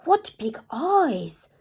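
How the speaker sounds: slow, loud gasp of surprise. Acted. Red noticing the disguised wolf, gasping. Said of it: "Red gasped".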